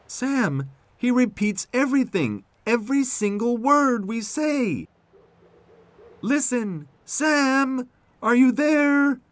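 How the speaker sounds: high-pitched, urgent tractor character; excitable tractor Tech. Acted. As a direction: call out in a very high cartoon voice.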